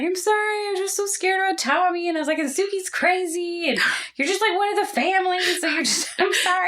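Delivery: hammed-up sorry; mock tearful apology. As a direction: whimper the apology. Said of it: "I felt so bad".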